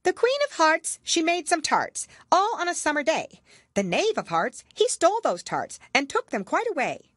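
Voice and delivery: high, loud sing-song recital. A narrator's reading. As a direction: announce the verse like a herald. Rabbit reads accusation verse.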